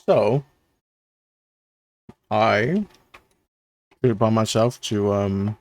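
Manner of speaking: talking while chewing food; mouth full. Real, not acted. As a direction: speak around a bite of food. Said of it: "sorry, I'm, like, eating also".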